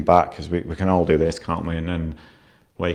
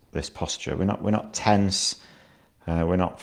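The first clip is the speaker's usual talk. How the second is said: guided meditation. Acted. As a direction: ease the listener down into stillness.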